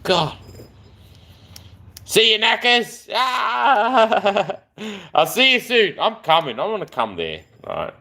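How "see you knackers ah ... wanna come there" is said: ordinary talk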